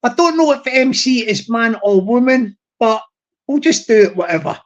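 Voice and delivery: ordinary talk, nothing marked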